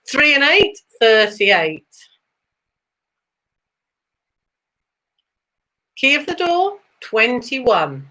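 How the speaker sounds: sing-song bingo number calls, rising then falling; bingo caller. Acted. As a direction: call each number like a bingo hall host.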